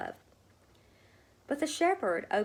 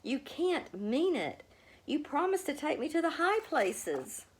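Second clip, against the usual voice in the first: pleading cry; 9 semitones higher than usual. A narrator's reading. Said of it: "she cried".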